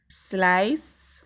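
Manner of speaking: ordinary talk, nothing marked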